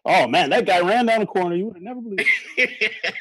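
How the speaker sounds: blown out, too loud mic; mic clipping on loud words; clipping distorted mic. Real, not acted. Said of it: "you sound a little blown out there".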